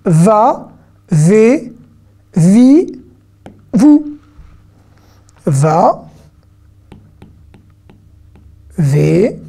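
drawn-out VA, VE, VI, VOUS, each said alone; syllable drill. Shown as a demonstration. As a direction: model each syllable slowly for learners, vowel held and gliding up. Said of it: "ça fait vous".

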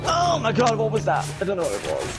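ordinary talk, nothing marked

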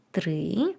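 usual speaking voice

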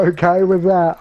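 excited shout. Real, not acted. Excited shout after collateral kill.

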